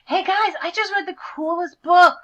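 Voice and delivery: eager shout. Acted. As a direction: call out to the cool birds, thrilled. Nerdy bird eagerly calls friends.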